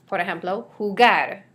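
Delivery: ordinary talk, nothing marked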